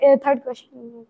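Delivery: usual speaking voice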